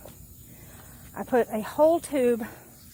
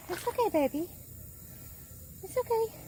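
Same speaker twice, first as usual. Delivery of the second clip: soothing a scared dog; 11 semitones higher, 5 dB quieter than usual. Real, not acted. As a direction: coo softly to calm him. Soothing scared puppy on leash.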